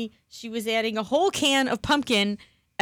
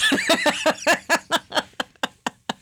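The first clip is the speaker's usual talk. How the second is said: big laugh; 7 semitones higher, 4 dB louder than usual. Real, not acted. Laughing fit.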